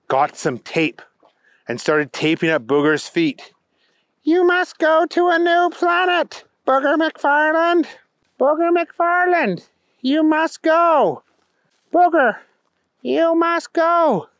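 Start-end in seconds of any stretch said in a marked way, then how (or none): 4.2-14.3 slow, high-pitched chanting command; alien king, insistent. A narrator's reading. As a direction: order him away, drawing out the words.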